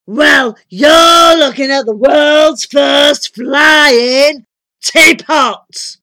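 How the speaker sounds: high, loud, drawn-out proclamation; booming giant. A narrator's reading. Giant wizard unveils the flying teapot, booming. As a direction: shout it slowly like a grand unveiling.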